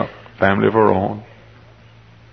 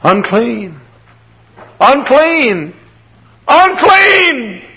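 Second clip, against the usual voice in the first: high drawn-out cry, building louder and higher; leper's warning cry; 4 dB louder, much slower than usual. Acted. Leper warns people in the street, crying out high. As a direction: call out a warning, each cry climbing.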